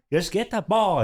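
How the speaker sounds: mild mock yell; retold dad yelling. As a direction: imitate a fed-up dad ordering his kid. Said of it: "yelling at him".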